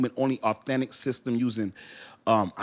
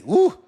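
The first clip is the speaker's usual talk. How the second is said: pained woo; 9 dB louder than usual. Real, not acted. Reliving the painful loss.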